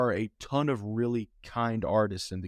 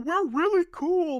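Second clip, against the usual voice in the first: much higher, slower, louder smug brag; mock their self-important boast; 4 dB louder, slower than usual. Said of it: "they were just like".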